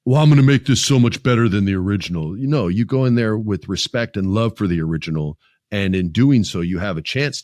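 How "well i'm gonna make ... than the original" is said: a hint of a smug director, slightly louder; lightly mock the boastful filmmaker; 1 arrogant director voice. Mocking directors who think they will improve the original, slightly raised smug voice. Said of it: "most directors aren't gonna go".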